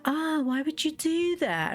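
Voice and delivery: mock shocked whine. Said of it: "they're like".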